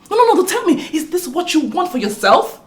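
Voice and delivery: high, loud sarcastic jeer; mocking taunt. Sister-in-law loudly mocks the wife's failing marriage. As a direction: taunt her with mock praise.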